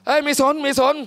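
high, brash, careless loudmouth; brash loud voice. Retold or imitated. Preacher playing Thabit ignoring the teacher, loud and brash. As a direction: toss it off like you don't care who hears. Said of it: "คุยลั่นเลย สมมุติ".

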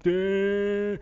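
high, loud drawn-out duh; hammed-up clueless official; mock dimwit. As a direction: drone it out loud and high, mocking. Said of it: "They're just like".

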